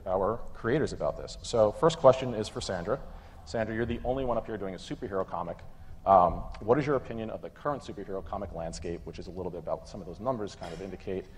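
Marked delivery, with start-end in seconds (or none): none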